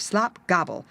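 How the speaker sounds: ordinary delivery, nothing marked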